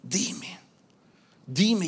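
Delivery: whispered plea to God; imitating a desperate private prayer; tearful pleading prayer. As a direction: beg in a breathy, slow whisper. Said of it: "lloraba y decía".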